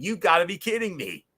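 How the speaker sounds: high-pitched disbelieving exclamation, pitch jumping up; mock stunned commentator cry; Don West exclaiming. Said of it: "had a Don West moment saying".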